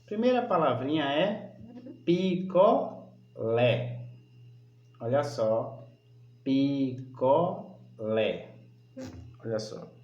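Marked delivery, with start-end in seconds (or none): 2.0-4.0 picolé drawn out slowly as pi, co, lé; syllable by syllable. Shown as a demonstration. Teacher showing pupils the stressed syllable, picolé split slowly into pi-co-lé. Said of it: "o que está destacado é a sílaba". 6.4-8.5 picolé very slow, a gap between pi, co and lé; syllable by syllable. Shown as a demonstration. Teacher repeating the example word for pupils, picolé split slowly into pi-co-lé. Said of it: "o que está destacado é a sílaba".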